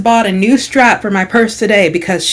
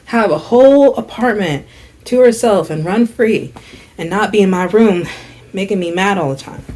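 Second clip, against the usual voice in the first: ordinary talk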